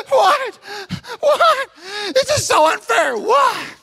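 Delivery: put-on high, loud whiny protest of a sulking kid. Kid complaining to the coach after a bad call, high whiny shout. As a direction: play the outraged kid yelling it's unfair. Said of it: "And they do this".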